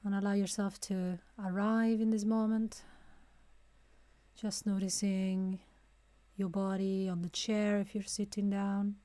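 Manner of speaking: calm, unhurried guide with long pauses; guided meditation. Real, not acted. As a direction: ease them into stillness, phrase by phrase. Leads a breathing meditation.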